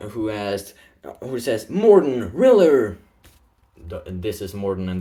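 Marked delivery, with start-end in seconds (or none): 1.7-3.0 loud greeting. Retold or imitated. Cultist greets the two heroes.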